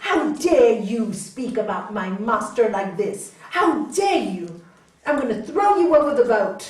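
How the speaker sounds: loud, indignant outburst of an outraged disciple; acted furious challenge; really angry. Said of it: "this disciple got really angry".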